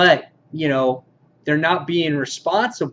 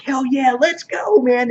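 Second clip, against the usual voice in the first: much higher, excited yell; mock pumped call; 7 semitones higher than usual. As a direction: hype it up, ready to go. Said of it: "he's just like".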